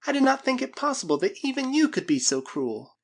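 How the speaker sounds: put-on high, reproachful girl's voice; girl crying out. A narrator's reading. Girl reproaches the Spaniard for cruelty, high distressed cry. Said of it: "cried the girl".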